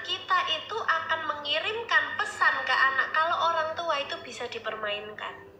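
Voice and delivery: usual speaking voice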